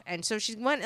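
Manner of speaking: ordinary delivery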